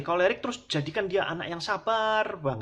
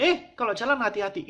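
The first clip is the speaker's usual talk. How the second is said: put-on sharp, louder and higher warning to a careless child; scolding parent; 7 semitones higher, 4 dB louder than usual. Parent scolding a child who dropped a bottle, sharp warning. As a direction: snap a quick warning at the kid.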